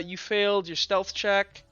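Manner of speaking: ordinary delivery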